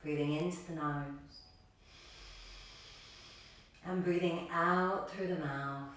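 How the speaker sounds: calm, low-key breathing cues, letting phrases settle; guided meditation. Acted. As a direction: guide them gently into stillness, unhurried.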